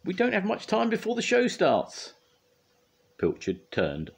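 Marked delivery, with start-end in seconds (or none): 0.0-2.1 very high, fretful voice; urgent pleading. A narrator's reading. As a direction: urge the cat to hurry back. Scoop urges the runaway cat.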